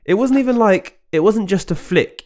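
high, excited disbelief. Real, not acted. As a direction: marvel at the skill. Excited about the goal.

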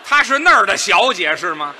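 loud, sharp question of mock indignation; comic loud challenge; indignant retort. Real, not acted. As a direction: fire it back, half outraged. Partner reacts loudly to the pun.

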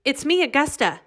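high calling out a name. Acted. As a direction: call her name through the door. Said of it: "she called".

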